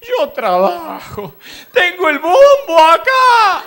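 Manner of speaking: a fed-up neighbor rising to a wail. Retold or imitated. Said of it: "entró a levantar la voz el hombre". (vecino quejándose, subiendo la voz hasta casi llorar)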